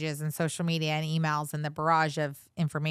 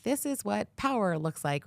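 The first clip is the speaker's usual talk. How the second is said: a slow, higher, lightly put-on presenter line; imitating video host; 5 semitones higher than usual. Quoting the host's social media videos, slow mock presenter voice. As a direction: mimic his punchy video catchphrase, a bit slow and lifted. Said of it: "the videos that you do where you're like".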